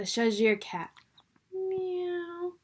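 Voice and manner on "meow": the cat arriving in court; cat meow. A narrator's reading.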